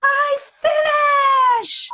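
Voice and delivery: put-on high, loud, slow child's yell; a child's triumphant cry when the last difference is found; child's shout. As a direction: shout it out like a kid who just finished. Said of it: "o menino grita assim".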